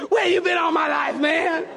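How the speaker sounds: high-pitched, elated cry; overjoyed outburst. Retold or imitated. Traveler to stranger who speaks English, overjoyed. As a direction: exclaim it like finding a long-lost friend.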